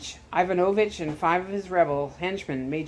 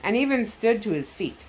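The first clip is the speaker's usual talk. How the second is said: ordinary delivery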